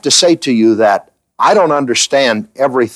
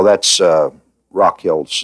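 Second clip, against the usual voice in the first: normal talking voice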